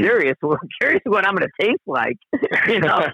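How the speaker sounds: amused disbelief, breaking into laughs; mock incredulity. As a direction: retell it as a baffled comeback, laughing through it. Retelling his own incredulous reply, laughing.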